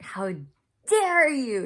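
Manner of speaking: loud, high retold outburst on a drawn-out "dare"; angry outburst. Telling off the mentor in retelling, angry. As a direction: burst out indignantly, reliving the anger. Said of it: "I was angry".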